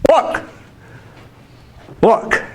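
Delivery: high, short attention call; playful excited call. Pretend. Teacher urging students to feel like Jake, excited. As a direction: echo a proud child wanting to be seen.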